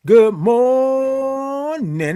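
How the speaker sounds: high, long-held "morning", stretched on the first syllable; big high stretched-out morning greeting; drawn-out greeting. Real, not acted. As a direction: sing out "good morning", holding "mor-" very long. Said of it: "I love all them O's and R's and I N Gs".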